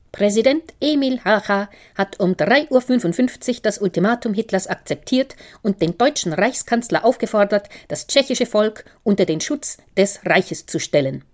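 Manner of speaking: radio newsreader announcing the ultimatum to the household; radio announcer. Acted. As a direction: read it out like a 1939 radio bulletin. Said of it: "wiederholte der Sprecher".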